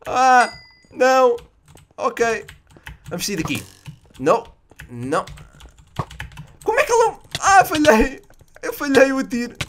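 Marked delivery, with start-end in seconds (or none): 0.1-1.4 high, loud cry of dismay; short loud protest; alarmed outcry. Real, not acted. Alarmed during the match. 6.6-9.6 high-pitched, half-laughing outcry; incredulous laughing protest; exasperated disbelief. Real, not acted. Missed his shot again.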